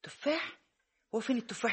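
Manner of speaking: high incredulous "apples?"; mock disbelief. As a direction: scoff in disbelief at the offer. Aunt mocks the apple offer.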